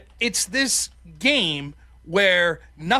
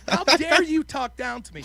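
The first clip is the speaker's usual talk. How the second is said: higher-pitched, mildly hammed-up outrage; retold indignation; 6 semitones higher, 4 dB louder than usual. Beaten debater to the condescending girl, mock outraged. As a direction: demand it as if deeply insulted. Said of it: "I was so mad".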